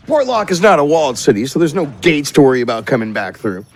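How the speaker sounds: ordinary talk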